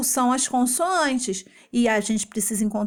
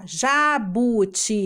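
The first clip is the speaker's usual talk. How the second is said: JABUTICABA broken into ja-bu-ti-ca-ba; syllable by syllable; slower than usual. Shown as a demonstration. Teacher counting syllables for children, jabuticaba syllable by syllable. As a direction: sound out each syllable of JABUTICABA separately. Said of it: "as sílabas são os pedacinhos".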